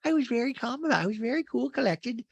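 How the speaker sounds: very high put-on voice; Rinna. Housewife on FaceTime defending herself, high-pitched mimicry. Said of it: "Rinna's on FaceTime with Kyle, goes".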